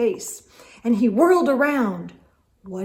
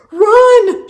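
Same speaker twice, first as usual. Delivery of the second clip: high loud yell to flee; captain yells at his crew to run; shout; 12 semitones higher, 13 dB louder than usual. Acted. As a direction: shout it as a warning. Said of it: "shouted the captain".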